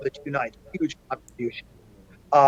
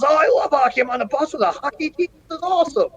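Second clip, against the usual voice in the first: much higher, gushing excitement; thrilled fan; 13 semitones higher than usual. Retold or imitated. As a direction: squeal it like a delighted fan. Cuban driver thrilled about hockey.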